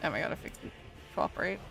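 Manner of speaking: ordinary talk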